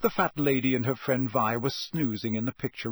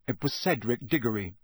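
plain reading voice

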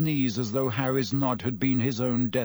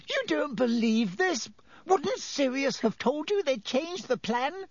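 high-pitched desperate appeal; imploring squeak; 13 semitones higher than usual. A narrator's reading. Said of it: "writhing imploringly in front of him".

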